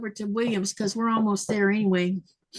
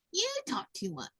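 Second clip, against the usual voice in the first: soft, high scold; 7 semitones higher, 11 dB quieter than usual. Real, not acted. Repeats scolding, softer.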